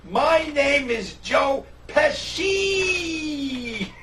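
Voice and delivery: very slow, loud, high shout of "My name is Joe Pesci"; exasperated shout. Retold or imitated. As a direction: bellow each word of the name, dragged out. Finally yells his name.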